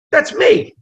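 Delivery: squeaky high that's me; stunned, pitch jumping up; shocked realization. Retold or imitated. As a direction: leap up in pitch as it dawns on you. Hearing himself on the radio.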